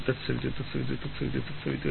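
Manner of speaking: తత్సవితు run together quickly and softly; quick soft repetition of తత్సవితు; said fast. Shown as a demonstration. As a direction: rattle off తత్సవితు in one breath.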